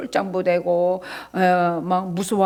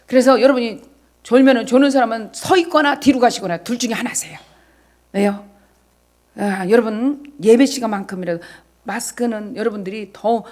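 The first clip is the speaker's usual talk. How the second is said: usual speaking voice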